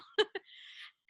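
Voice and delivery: a quick breathy giggle after her own joke; bright high laugh. Real, not acted. Joking about emailing the group, a short high laugh.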